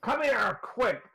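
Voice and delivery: high, urgent shouting for help; urgent call. Acted. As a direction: hurry the healer over in alarm. Husband NPC calls for help.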